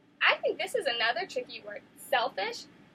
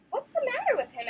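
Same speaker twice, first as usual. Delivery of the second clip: much higher, timid animal voice; a deer asking about the crocodile, high voice; gentle deer; 11 semitones higher, 3 dB louder than usual. A narrator's reading. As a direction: voice a small worried deer. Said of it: "asked a deer".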